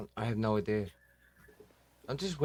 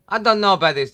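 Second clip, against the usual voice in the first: excited teasing; 8 semitones higher, 10 dB louder than usual. Real, not acted. Teasing Hector loudly.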